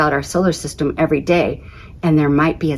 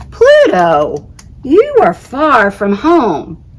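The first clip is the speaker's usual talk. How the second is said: slow, louder, grand voice; the sun speaking; 5 semitones higher, 5 dB louder, much slower than usual. Acted. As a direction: speak slowly like a great sun. Sun character voiced in story.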